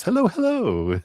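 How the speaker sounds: hello, hello sung up high and bright; high sing-song greeting. Real, not acted. Reader greets Maria arriving in chat, high sing-song hello.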